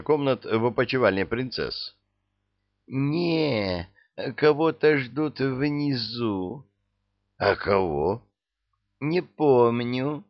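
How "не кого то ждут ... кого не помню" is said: put-on high tipsy girl voice; slow, high, dreamy drunk; пьяная девушка. Пьяная Розочка. (пьяный девичий голос)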